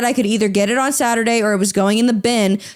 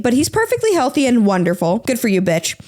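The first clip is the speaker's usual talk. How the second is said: normal talking voice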